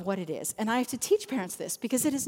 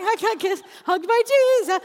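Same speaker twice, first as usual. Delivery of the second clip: high, loud mimicked kid voice; hammed-up preschooler, very high and loud; excited little kids; 11 semitones higher, 8 dB louder than usual. Kids coming out of the hug corner, mimicked excitement.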